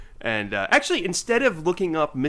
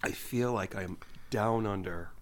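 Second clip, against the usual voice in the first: lightly voiced smooth ad read, slow at the end; a hint of a soft, savoring commercial voice; playful understated wine ad voice; mock wine commercial; 2 semitones lower, 5 dB quieter, slower than usual. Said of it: "my little commercial for Yellowtail".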